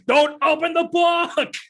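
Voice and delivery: high, slow, loud mock cry; drawn-out high-pitched yell, playing it up; hammed-up movie yell. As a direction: holler it like an overblown movie line. Shouting a quoted movie line.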